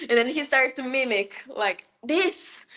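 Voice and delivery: ordinary talk, nothing marked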